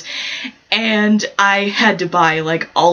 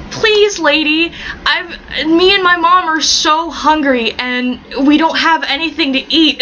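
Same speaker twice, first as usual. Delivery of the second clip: slow, higher mock begging; pleading child; 6 semitones higher, slower than usual. Kid begging an old lady for food, hammed-up pleading. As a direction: draw it out like a hammed-up starving kid.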